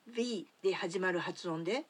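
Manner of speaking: ordinary talk, nothing marked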